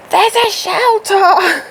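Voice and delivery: hammed-up shout at silly horses; mock yell at horses. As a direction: pretend to yell across the field at the horses. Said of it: "screaming at them".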